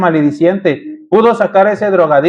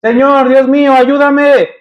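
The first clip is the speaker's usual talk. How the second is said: higher, pleading cries to a father; anguished cry to father; 5 semitones higher, slower than usual. Retold or imitated. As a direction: cry out "Papi" higher, begging for help. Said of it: "aunque Él gritaba".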